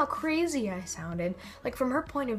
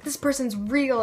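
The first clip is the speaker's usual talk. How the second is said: louder, higher retold insistence; mock yelling; 4 semitones higher, 5 dB louder than usual. Student insisting to friend about the teacher, mild mock yell. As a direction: imitate yourself yelling, only mildly. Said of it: "this entire one hour conversation screaming".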